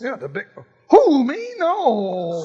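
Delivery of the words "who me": high, loud startled yelp. Acted. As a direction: jump back in alarm. Asked to lie in box.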